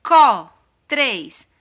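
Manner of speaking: Co punched loud, then counted; syllable beat. Shown as a demonstration. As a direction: hit Co hard and count três. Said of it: "Coloca o dedinho no queixo".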